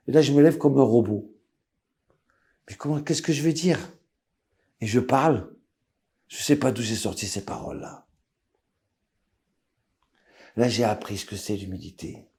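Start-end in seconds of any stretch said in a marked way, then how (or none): none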